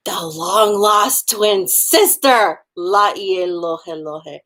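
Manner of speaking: very slow, drawn-out reveal; dramatic reveal. Pretend. Teacher revealing the long-lost twin, very slow. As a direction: stretch every word like a soap twist.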